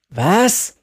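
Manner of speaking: high, rising outcry; shocked cry. A narrator's reading. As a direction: cry out in disbelief. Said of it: "rief Jerry".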